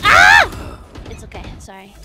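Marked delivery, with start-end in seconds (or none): 0.0-0.8 sharp loud yell of frustration. Real, not acted. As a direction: let out a sudden loud yell. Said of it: "Sorry for shouting so loudly".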